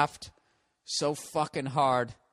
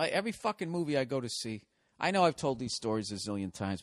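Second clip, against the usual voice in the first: ordinary talk, nothing marked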